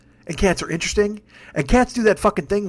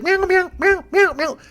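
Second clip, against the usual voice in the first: much higher, squeaky cat voice; frantic cat meows; 11 semitones higher, slower than usual. Retold or imitated. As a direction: imitate a panicked cat meowing. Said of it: "He's like".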